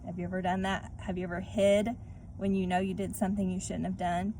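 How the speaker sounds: plain reading voice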